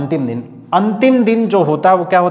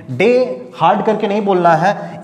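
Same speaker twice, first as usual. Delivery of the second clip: ordinary delivery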